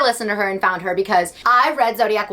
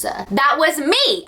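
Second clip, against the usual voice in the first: usual speaking voice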